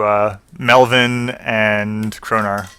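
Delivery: ordinary delivery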